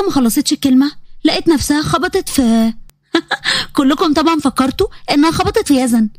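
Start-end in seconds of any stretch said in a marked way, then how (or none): 3.1-3.7 laugh. Real, not acted. Narrator laughs at twist.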